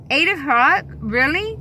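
can't believe the wait; incredulous. Real, not acted. Outraged at the long wait.

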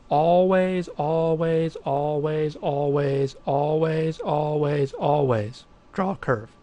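each always hammered home, the first one loudest, then draw a curve said slowly; insistent teacher repeating always until it sticks; emphatic repetition. Shown as a demonstration. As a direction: drill the word always into the students. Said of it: "Hopefully I'm emphatic about that".

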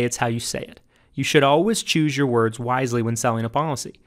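ordinary talk, nothing marked

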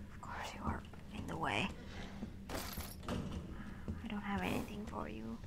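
whispered, muttered complaint; annoyed stage whisper to an enemy in the way; whispered grumble. Real, not acted. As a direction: whisper it under your breath in annoyance. Whispering to a blocker.

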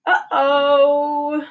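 high, sing-song uh-oh; a cartoonish oops for the kids; playful uh-oh. Refrain voiced by book character.

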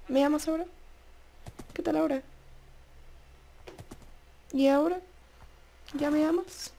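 high, hopeful pleading questions. Pretend. Gamer asking the horse for love, high pleading. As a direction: coax the horse sweetly.

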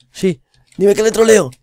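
very high, loud yell rising to a scream; hope, then shriek of defeat; hopeful yell then scream. Real, not acted. Streamer caught by the beast, hopeful yell into a loud scream.

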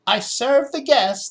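high, thin ghostly voice. A narrator's reading. Ghost servant speaks from the doorway, thin and high. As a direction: float it like a faint ghost. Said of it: "ghostly sounding whisper".

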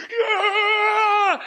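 high laughing shout; laughing high whoop; crowd cheer. Retold or imitated. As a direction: yell like eighty guys going nuts. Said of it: "eighty guys".

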